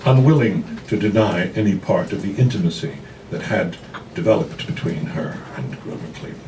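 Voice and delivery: usual speaking voice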